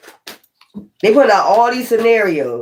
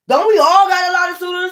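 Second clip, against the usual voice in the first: incredulous; 10 semitones higher than usual. Real, not acted. Indignant retort to accusers.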